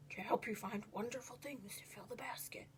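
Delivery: hushed conspiratorial whisper. A narrator's reading. Uncle Vernon tips off Desmond in secret, whispering. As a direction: breathe it like a secret tip. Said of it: "he whispered".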